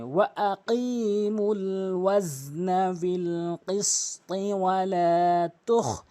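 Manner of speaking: slow, drawn-out chanted recitation; Quran recitation. Shown as a demonstration. Tajweed teacher reciting the verse for students, slow and melodic. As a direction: recite it melodically, stretching every vowel.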